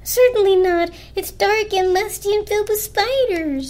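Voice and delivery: high, appalled blanket voice; horrified refusal. A narrator's reading. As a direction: refuse in squeamish disgust.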